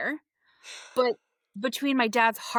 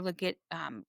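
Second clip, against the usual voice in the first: ordinary talk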